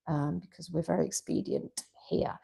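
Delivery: whispered joking aside; tongue-in-cheek stage whisper. Acted.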